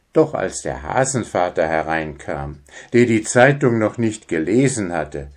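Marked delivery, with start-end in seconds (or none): none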